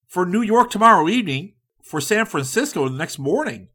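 high, loud and hurried; no time to fool around; impatient go-getter. A narrator's reading. Likens insisting on tonight, high and hasty.

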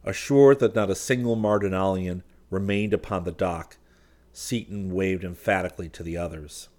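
ordinary delivery, nothing marked

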